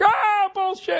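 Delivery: sudden high yelp of disbelief; indignant disbelief. Retold or imitated. Old redhead scoffing at mockers, shocked high cry. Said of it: "Then I'll be like".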